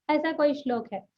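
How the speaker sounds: usual speaking voice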